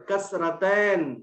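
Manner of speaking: "कसरतैन" said very slowly, falling at the end; drawn-out term. Shown as a demonstration. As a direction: spell it out for a beginner. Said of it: "तो दो जेर को क्या बोलेंगे". (अरबी लफ़्ज़ धीरे, आख़िर में नीचे)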